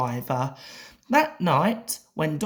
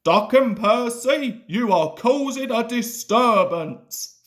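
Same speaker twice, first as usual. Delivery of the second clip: loud, high, thundering rebuke; 6 semitones higher, 4 dB louder than usual. A narrator's reading. Fat Controller thundering at Duck and Percy. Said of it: "thundered the Fat Controller".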